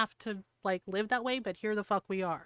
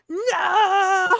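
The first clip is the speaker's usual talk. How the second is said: long, high, very loud scream; 8 semitones higher, 11 dB louder than usual. Retold or imitated. Friend screaming at men who come up to her, full scream. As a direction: scream it suddenly in someone's face. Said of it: "She'll just scream in their face".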